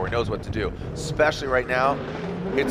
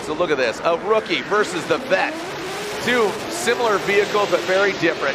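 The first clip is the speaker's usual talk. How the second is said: excited; 7 semitones higher, 4 dB louder than usual. Real, not acted. Commentator hypes the matchup start.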